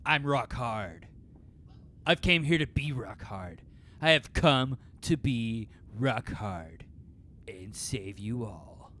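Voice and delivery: slow, fading to soft; slow grand declaration. Acted. Seth declares himself to the goldfish crowd, slow and solemn. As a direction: proclaim it heavily, then trail off.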